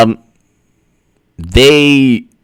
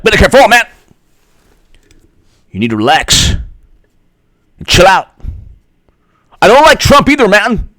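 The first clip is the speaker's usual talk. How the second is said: mock chill pundit; 7 semitones higher, faster than usual. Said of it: "pundits who are like".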